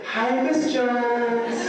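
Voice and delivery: put-on high, long drawn-out childlike greeting; reverting to a little pupil's high voice; pitch shooting up. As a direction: greet her with a high, stretched-out squeal. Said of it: "my pitch went up five octaves".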